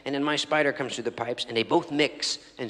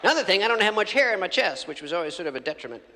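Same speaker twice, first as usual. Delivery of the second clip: ordinary delivery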